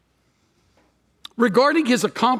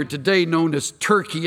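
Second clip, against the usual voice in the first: usual speaking voice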